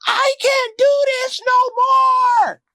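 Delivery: very high, drawn-out screaming; imitated yell. Kayla screaming at her baby daddy, very high slow wail. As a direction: wail it slowly at the top of the voice. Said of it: "Kayla yelling like".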